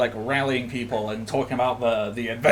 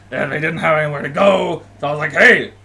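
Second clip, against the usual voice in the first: lightly voiced boisterous seafarer, a bit loud; a hint of a rough-and-ready crew captain; Yozora; 3 semitones higher, 5 dB louder than usual. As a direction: explain yourself in a slightly raised, hearty character voice. Plays Yozora explaining himself to player.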